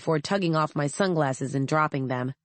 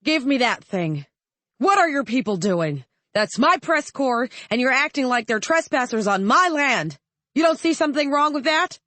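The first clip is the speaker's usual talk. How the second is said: much higher, louder outraged demand; indignant senator over loudspeaker; 8 semitones higher, 3 dB louder than usual. A narrator's reading. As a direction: blast the soldiers for treating his press like trespassers. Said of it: "Senator Ryman's voice came over the loudspeaker".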